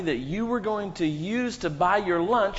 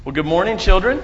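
loud greeting; 4 semitones higher, 11 dB louder, faster than usual. Real, not acted. Pastor calling children up front, loud cheerful greeting. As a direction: call out the greeting louder.

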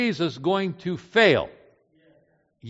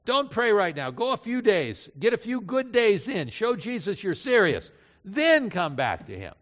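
high-pitched, persuasive tempter; Satan; 6 semitones higher than usual. Acted. The tempter advising a failed believer to stay away, persuasively. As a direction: coax with raised pitch, like offering sensible advice. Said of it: "says Satan".

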